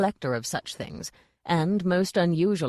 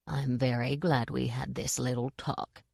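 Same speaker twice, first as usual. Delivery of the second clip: hissed; 4 semitones lower, 6 dB quieter than usual. A narrator's reading. Nanny mocks Granny, soft low hiss. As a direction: lower and softer, venomous hiss. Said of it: "hissed Nanny Ogg".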